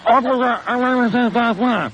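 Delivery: high, through a mouthful of juice; mouth full. Real, not acted. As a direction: say it with cheeks full. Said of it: "hold orange juice in my mouth".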